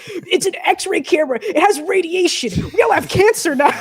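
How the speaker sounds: high, hammed-up panicked yell; screaming, freaking out. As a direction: mimic a crew freaking out, breaking into laughter. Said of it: "start screaming and freaking out".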